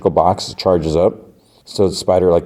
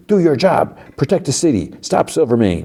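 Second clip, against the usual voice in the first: high-pitched retold bark, only mildly forceful; barked commands, acted without real shouting; mock barking; 8 semitones higher than usual. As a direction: imitate an irate mayor giving orders. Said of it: "he barks at the chief".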